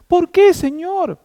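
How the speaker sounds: high, slow plaintive appeal; pleading question. Retold or imitated. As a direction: cry out to God in retold protest. Acts out his pleading with God.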